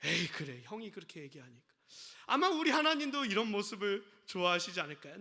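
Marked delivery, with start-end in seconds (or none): 0.0-1.7 softened father. Retold or imitated. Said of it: "아버지도 화를 내려다가도".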